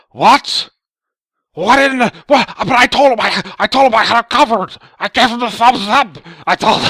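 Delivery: high-pitched furious mock shouting; hammed-up screaming tantrum; freaking out. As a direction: imitate a raging man-baby yelling. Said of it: "he starts freaking out".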